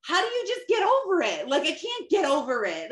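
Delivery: real exasperation at being told to get over it; exasperated outburst. Real, not acted. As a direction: throw the question back in frustration. Exasperated at husband's advice.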